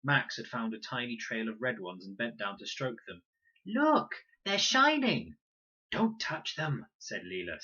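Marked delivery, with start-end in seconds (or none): 3.6-5.4 high, loud and slow, full of wonder; a small child thrilled; delighted child. A narrator's reading. Little Max showing the shining ants, high and delighted. 5.9-6.9 urgent warning. A narrator's reading. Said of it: "snatching him back".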